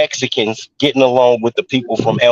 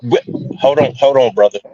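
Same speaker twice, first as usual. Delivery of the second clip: muffled, like something rubbing the mic; 2 semitones higher than usual. Real, not acted. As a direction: speak through a covered mic. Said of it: "sounds like it's muffled".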